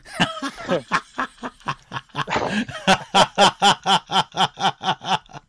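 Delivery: helpless high-pitched laughing fit; breaking into a long laugh; long high burst of laughter. Real, not acted. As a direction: crack up at the punchline. Laughing at own punchline.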